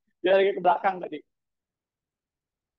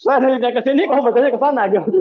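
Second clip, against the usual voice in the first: loud, laughing disbelief at the answer; laughing exclaim; 5 semitones higher, 6 dB louder than usual. Real, not acted. Teacher laughing at a student reading it backwards, loud and amused. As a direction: laugh it out, amazed they read it backwards.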